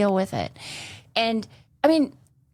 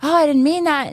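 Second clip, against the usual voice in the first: mock innocent; 7 semitones higher, 5 dB louder than usual. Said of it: "I can sit here and say".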